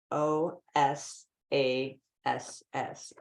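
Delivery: each letter slow and separate; spelled slowly. Shown as a demonstration. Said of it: "spelling the word out".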